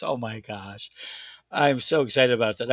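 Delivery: usual speaking voice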